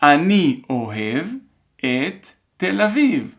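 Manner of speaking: careful dictation in separated chunks; measured phrase-by-phrase dictation. Shown as a demonstration. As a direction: read it out for learners to write, pausing between chunks. Troisième fois.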